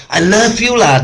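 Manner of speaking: annoyed I love you. Shown as a demonstration. Showing how tone changes meaning, exasperated. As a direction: toss it off, tired of being asked. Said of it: "don't ask so many times".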